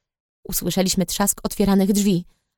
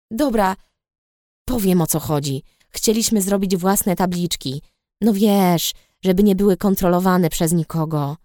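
ordinary delivery

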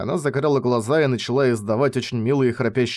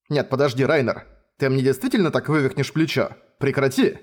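usual speaking voice

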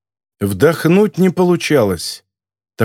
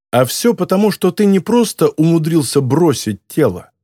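ordinary delivery

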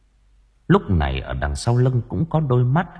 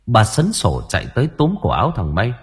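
ordinary delivery

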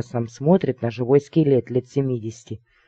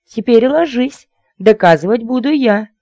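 high, loud, squeally order; squeally lady; 10 semitones higher, 6 dB louder than usual. A narrator's reading. Lady ordering her shocked suitor down, squeally. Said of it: "тонкий визгливый голосок".

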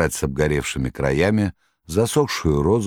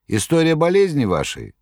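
much higher jester voice; Koroviev; 11 semitones higher than usual. Acted. Koroviev reassuring the master, high clownish voice. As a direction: jeer like a clownish trickster.

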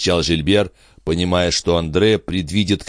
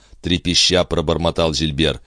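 plain reading voice

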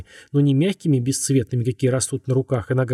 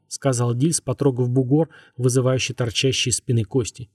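plain reading voice

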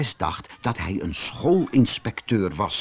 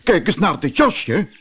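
eager call, pitched up; bursting to show off the jacket; excited; 6 semitones higher, 3 dB louder than usual. Acted. Professor rushing in to his wife, excited. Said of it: "Opgewonden rende hij naar binnen".

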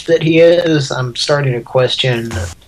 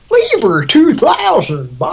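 high mock commercial voice; 13 semitones higher, 3 dB louder than usual. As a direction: pitch it like a TV ad. Mock advert voice.

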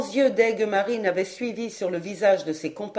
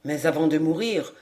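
low, soft dying elder; serene; 7 semitones lower, 4 dB quieter than usual. A narrator's reading. Dying old man announces his last moments, serene. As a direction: speak calmly of the end, voice lowered. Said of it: "répondit Chariclès avec sérénité".

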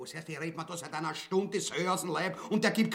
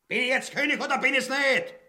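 angry demand; 3 semitones higher, 6 dB louder, faster than usual. Acted. Tyrant asserts his power.

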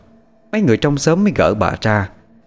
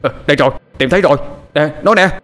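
urgent high shout of discovery, very fast at first; acted alarmed call; crying out; 6 semitones higher, faster than usual. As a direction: shout to the searchers that you've found her. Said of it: "có ai đó kêu lên".

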